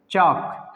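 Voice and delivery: चाक with no L before K; silent L. Shown as a demonstration. Teacher answers his own question, चाक without L. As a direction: say चाक leaving L out. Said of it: "क्या पढ़ेंगे".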